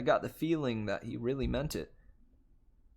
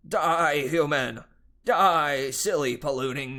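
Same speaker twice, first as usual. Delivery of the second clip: gamer battle cry; 3 semitones higher, 7 dB louder, slower than usual. Acted. Gamer satyr yelling at screen.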